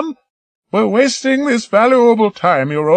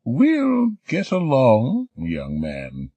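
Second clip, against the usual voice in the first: chuckling; 9 semitones higher, much slower than usual. Acted. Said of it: "she chuckled".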